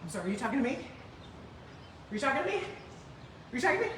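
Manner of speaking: soft, rising, incredulous question; restrained, affronted disbelief; indignant comeback. Retold or imitated. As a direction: challenge them quietly, pitch climbing. Rehearsed indignant comeback to clerk.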